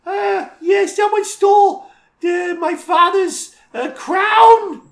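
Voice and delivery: very high, loud, squawking character voice; ridiculous quest-giver voice. Shown as a demonstration. Game master showing a silly voice for quest explaining, ridiculous high shout. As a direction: shriek it out in a silly high-pitched voice. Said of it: "explaining the quest with this voice".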